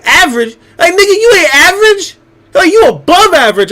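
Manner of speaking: high, loud mock outrage at being called average; imitating buddy, worked up. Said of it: "he'll be like".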